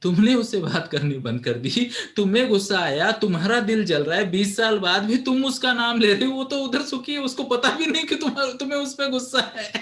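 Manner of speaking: builds from firm to high, loud rebuke; building lecture to a grudge-holding friend; rising scolding. Acted. Scolding friend over 20-year grudge.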